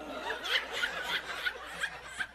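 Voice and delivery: soundless wheezing laugh; helpless wheeze of laughter; high breathless laugh. Real, not acted. Laughing at the memory.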